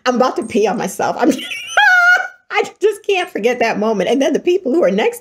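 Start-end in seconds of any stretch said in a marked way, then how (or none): 1.3-2.5 very high, loud squeal of laughter; real hysterical laugh. Real, not acted. Remembering nearly peeing herself, helpless high squealing laugh. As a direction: lose it completely, laughing. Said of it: "I'm laughing so hard".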